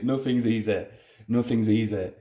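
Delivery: ordinary talk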